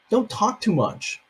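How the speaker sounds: ordinary delivery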